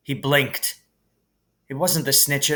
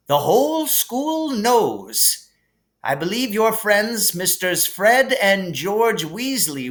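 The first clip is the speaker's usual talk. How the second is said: higher, gently amused headmaster voice; kindly old headmaster; 7 semitones higher than usual. Acted. Dumbledore calming Harry in the hospital wing, gently amused. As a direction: soothe the boy like a kindly wise old wizard.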